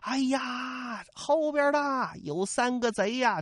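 high, slow call over the shoulder; shouting back. Acted. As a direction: yell back to the others. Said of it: "朱仝回头喊了一声".

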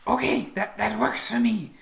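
high, loud creature voice; agreeable creature. Acted. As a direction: agree readily, pitched well up. Game master voices the creature replying.

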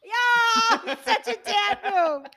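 laughing through the words, high and loud. Real, not acted. Friend laughing at a dad joke, high and loud. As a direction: crack up while agreeing.

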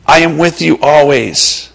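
usual speaking voice

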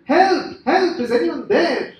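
high, loud hammed-up shout for help; shouted cry for help. Trumpeter hanging from a branch calls for rescue, high shouting. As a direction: call out for rescue in mock desperation. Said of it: "He shouted".

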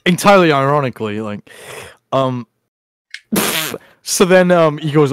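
normal talking voice

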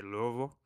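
"Louisville" run together as if gargling; gargled local Louisville. Retold or imitated. Co-host imitating people in Ohio, gargled pronunciation. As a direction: say "Louisville" as LOO-uh-vul, vowels swallowed. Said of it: "it's like they're gargling".

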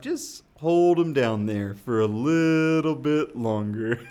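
slow, loud and drawn out with relish; gleeful mock menace. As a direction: relish the mischief, stretching it out toward a laugh. Playful tale of dunking his son.

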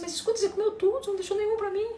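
high, indignant accusing voice; angry complaint. Retold or imitated. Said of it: "pro parceiro e falou assim". (cliente acusando o parceiro, voz aguda e indignada)

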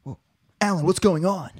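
wooden overacted surprise; deliberately terrible line reading; bad acting. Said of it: "I'm a terrible actor is the joke".

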